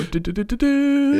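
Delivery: high sung game-show wheel noise; spinning-wheel jingle. Acted. Podcaster mimics the fine wheel spinning, playful high jingle. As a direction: imitate a wheel spinning, last note held high.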